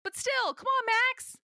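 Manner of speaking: high exasperated plea. Real, not acted. Exasperated at Max.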